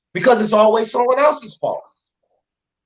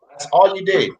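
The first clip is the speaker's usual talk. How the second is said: usual speaking voice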